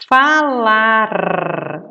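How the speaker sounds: Falar with the final R said loud and clear; final R shown. Shown as a demonstration. Teacher to pupils, showing the final R in Falar. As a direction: land hard on the R at the end of Falar. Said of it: "É o R no final da sílaba".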